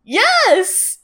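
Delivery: high-pitched gleeful exclamation; excited confirmation. Real, not acted. Storyteller confirming the name Lyman to co-host, excited. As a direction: confirm it with real delight.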